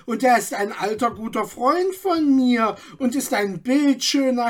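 high, shrill-edged gossip, full of herself; piercing self-important voice. A narrator's reading. Gossip Margaret answers the crowd, piercing and self-important. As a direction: announce it like the town's know-it-all. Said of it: "gellender Stimme".

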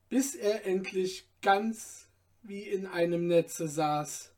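plain reading voice

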